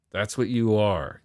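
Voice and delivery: ordinary delivery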